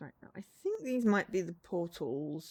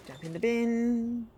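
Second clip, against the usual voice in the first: drawn out, louder eager call; slow excited command; 4 semitones higher, 6 dB louder, slower than usual. Real, not acted. Cheering herself on while jumping into a bin, slow and loud. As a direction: stretch it out as you leap.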